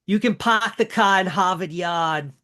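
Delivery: put-on slow, stretched Boston accent with dropped r's. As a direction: pahk the cah in Hahvahd Yahd, Boston style. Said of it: "my Boston accent".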